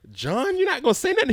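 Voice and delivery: high-pitched prodding mom; squeaky imitation of the mother; mother's high voice. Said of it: "And she was like".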